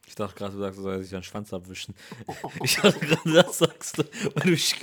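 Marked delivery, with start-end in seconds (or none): 2.6-4.8 louder and higher, laughing through the words; cracking up at his own dirty mishearing. Real, not acted. Laughing as he explains a misheard dirty word to his friend.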